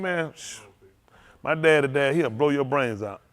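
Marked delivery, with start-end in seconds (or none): 0.4-0.6 soft hushing shh; shushing. Retold or imitated. Old people hushing a talkative kid, soft shh. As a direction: hush them like an old elder. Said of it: "old people".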